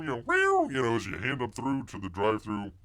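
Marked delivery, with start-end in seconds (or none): 0.3-0.7 very high, loud cat screech; sudden squealed meow; imitated cat yowl. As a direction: yowl like a cat being handed over. Imitates cat handed through drive-through.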